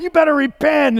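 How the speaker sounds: high mock-preacher warning; intimidating preacher. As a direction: imitate a preacher bearing down on the listener. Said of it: "looking right at you while he's preaching".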